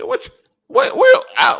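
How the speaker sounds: sudden high shouted "what"; shouting, caught off guard by a question; startled outburst. Real, not acted. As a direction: jump up in pitch, yelling in disbelief. Baffled reaction to a viewer.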